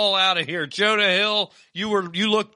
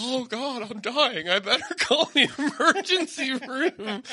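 laughing; 7 semitones higher than usual. Real, not acted. Host still laughing.

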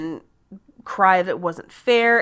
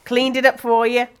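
breezy offhand quote; 4 semitones higher, 4 dB louder than usual. Retold or imitated. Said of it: "Just like".

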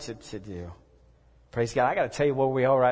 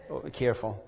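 ordinary talk

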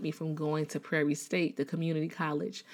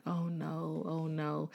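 soft worried teacher; 8 dB quieter, slower than usual. Retold or imitated. Concerned teacher comforting crying student.

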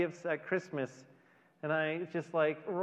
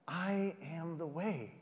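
softer, slow, every word weighed; quiet and slow; 6 dB quieter, slower than usual. Shown as a demonstration. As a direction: say it softly, letting it puzzle. Repeats the words quietly.

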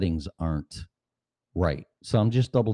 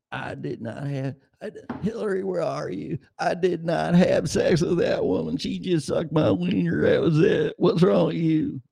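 much higher put-on ex-president voice; politician impersonation; 9 semitones higher than usual. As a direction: ham up the scandal denial, pitched way up.

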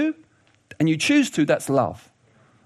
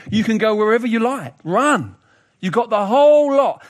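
higher, expansive voice of generous permission; exuberant invitation; 4 semitones higher, 5 dB louder than usual. Retold or imitated. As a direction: send them off freely, swelling on the last phrase.

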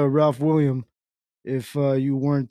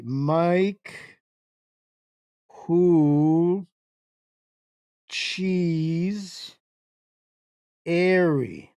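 word by word with long pauses; very slow; much slower than usual. Shown as a demonstration. Streamer obliging viewers who want him to slow down, very slow. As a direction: drag out each word, leaving big gaps. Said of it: "I have to slow down for this?".

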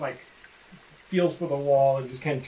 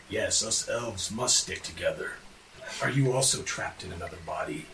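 Quaggoth voice; 6 semitones lower, 4 dB quieter than usual. Acted. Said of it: "He says".

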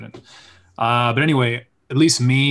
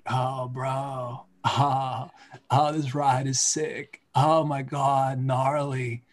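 slow, laid-back surfer dude, very Keanu; 3 semitones higher, much slower than usual. Shown as a demonstration. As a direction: drag out the words like a stoked surfer. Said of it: "I did, like, a surfer dude".

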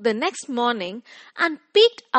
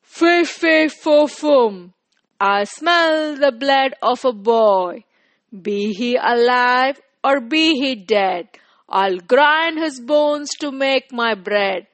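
loud, booming giant chant; dim giant cyclops; 5 dB louder than usual. Acted. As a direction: boom it out like a giant. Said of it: "cried the giant".

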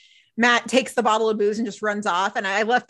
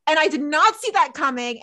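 usual speaking voice

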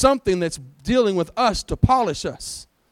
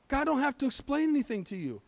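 higher, much softer restatement; soft repeat; 4 semitones higher, 8 dB quieter than usual. Shown as a demonstration. Preacher repeats it for doubters in the congregation, softly. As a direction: say it gently, pitched up and hushed. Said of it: "Do I need to say it again?".

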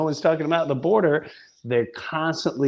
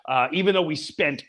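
ordinary talk, nothing marked

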